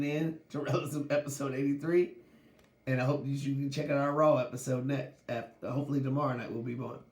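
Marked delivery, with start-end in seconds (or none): none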